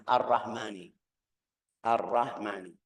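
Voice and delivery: "Ar-Rahmani" with a short, weak h; mispronounced ha. Shown as a demonstration. Teacher showing non-Arabs' mistake, short weak ha. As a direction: slip over the h in "Ar-Rahmani" without stretching it. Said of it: "salahnya di situ, u-, ucapannya".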